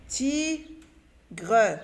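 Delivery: TIGRE split into "ti", pause, "gre", slowly; syllable by syllable. Shown as a demonstration. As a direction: sound out "tigre" as two separate syllables, "ti... gre". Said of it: "relier chaque syllabe". (« tigre » découpé lentement : « ti... gre »)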